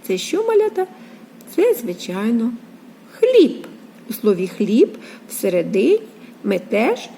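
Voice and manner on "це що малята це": usual speaking voice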